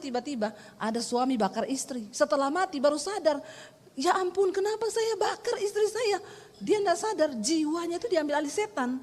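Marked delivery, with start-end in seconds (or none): 3.9-6.2 high, stunned cry of regret; mock-shocked husband; horrified realization. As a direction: act out the husband waking up to what he did. Husband realizes he burned wife.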